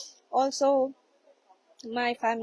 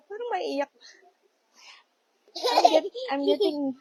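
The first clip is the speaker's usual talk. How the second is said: on the verge of tears; 6 semitones higher, 5 dB louder than usual. Real, not acted. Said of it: "I'm getting emotional".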